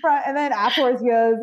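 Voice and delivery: ordinary delivery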